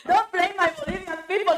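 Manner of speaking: high, loud, laughing through the words; laughing protest. Real, not acted. Guest laughing back at the host's accent joke, laughing. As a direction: laugh it off while defending yourself.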